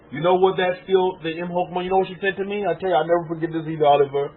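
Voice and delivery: ordinary talk, nothing marked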